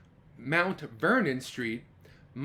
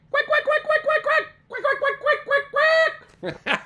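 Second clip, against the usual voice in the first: even higher loud playful quacking with laughs; duck quacks; 8 dB louder than usual. Shown as a demonstration. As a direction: quack along for the kids. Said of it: "Let me hear you".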